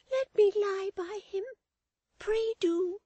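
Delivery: put-on very high, loud, slow child voice; wildly crying child. A narrator's reading. Florence pleads to nurse about her brother, wild child cry. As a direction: cry out as a pleading little girl. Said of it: "cried the child wildly".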